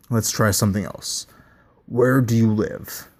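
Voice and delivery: ordinary delivery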